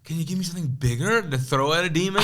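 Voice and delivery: high, loud incredulous plea; high-pitched plea. Retold or imitated. Begging his friend for a bigger Bible, high mock panic. Scared joke about fighting demons.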